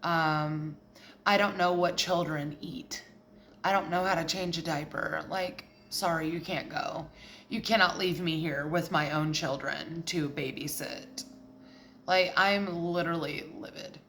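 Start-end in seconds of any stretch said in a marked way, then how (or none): 0.0-11.2 low, softer mock-helpless partner; controlling partner. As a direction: refuse in a low, subdued partner's voice. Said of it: "whoever you're with is like".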